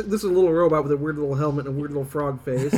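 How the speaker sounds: usual speaking voice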